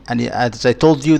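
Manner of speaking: usual speaking voice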